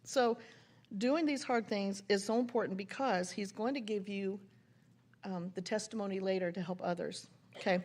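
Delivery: ordinary delivery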